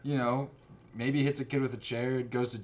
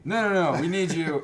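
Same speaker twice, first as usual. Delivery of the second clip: high, loud protest; real insistent objection; urgent reassurance; 6 semitones higher, 7 dB louder than usual. Real, not acted. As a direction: call them back, high and loud. Stops co-host from leaving.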